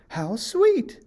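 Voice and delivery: put-on girlish wonder at a tiny house. A narrator's reading. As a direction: coo over the cottage.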